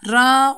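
"ra" with a heavy, full a; heavy "ra", not a light "re"; thick Arabic ra. Shown as a demonstration. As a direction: say "ra" thick, as the correct reading. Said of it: "Kalın bir şekilde".

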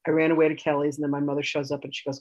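ordinary delivery

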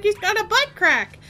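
lightly voiced little kid; a hint of a child. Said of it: "he was like".

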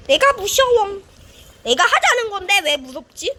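ordinary delivery

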